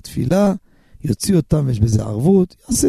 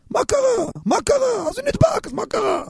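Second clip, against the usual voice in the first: much higher, softer dismissive mock; mock shrug; 4 dB quieter than usual. Preacher mocking Shabbat indifference, high dismissive mock.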